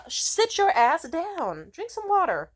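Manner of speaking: high, loud, slow order; exasperated scolding. Acted. Telling off drunk heckling men, loud high scolding. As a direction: lay down the law to a drunk.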